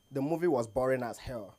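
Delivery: ordinary talk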